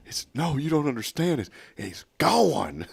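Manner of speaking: high, slow lament ending in gone; mock sad. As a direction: play the ad man's overacted grief. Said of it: "he goes".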